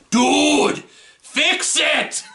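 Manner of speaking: high, exasperated yell; mock yelling. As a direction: yell it at the camera like an annoyed viewer. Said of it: "like, yelling at the camera".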